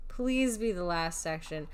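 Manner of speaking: usual speaking voice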